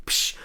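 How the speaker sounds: hissed "psh" drum sting; imitated cymbal crash. Host punctuates own zinger, mouthed cymbal crash. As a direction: mimic a cymbal after a joke. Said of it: "hit the drums".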